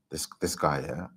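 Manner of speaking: usual speaking voice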